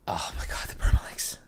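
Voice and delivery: hushed, voiceless realization; aghast whisper; whispered dismay. Real, not acted. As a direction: whisper it as the mistake dawns on you. Dismay at forgotten permalinks.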